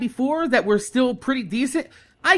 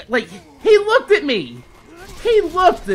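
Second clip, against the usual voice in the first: usual speaking voice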